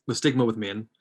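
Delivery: normal talking voice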